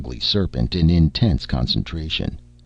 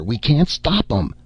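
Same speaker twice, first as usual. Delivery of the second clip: higher, frightened cry; panicked scream; 6 semitones higher than usual. Acted. Donner crying out to the crew in panic. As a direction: shout it as the walls give way. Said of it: "screamed Donner".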